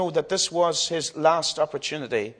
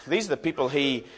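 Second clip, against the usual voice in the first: normal talking voice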